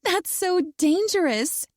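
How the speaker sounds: high, slow mocking imitation of another woman; mock her in a high, prissy voice; mocking shrill mimic. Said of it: "I mimic her shrill tone".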